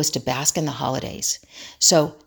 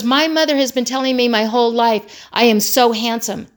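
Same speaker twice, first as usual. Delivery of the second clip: raised, indignant mock disbelief; stunned; 5 semitones higher, 4 dB louder than usual. Son defending what mother told him, stunned imitation. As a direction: imitate a son who cannot believe he's been contradicted. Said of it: "he was genuinely stunned".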